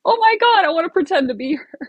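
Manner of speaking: squealed high and loud, then rushed; mock excited fangirl. [name] mocking a gushing [name] fan, mock squeal. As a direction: gush like a starstruck fan in a high burst. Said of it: "It's just not something that I'm like".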